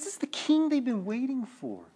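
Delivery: usual speaking voice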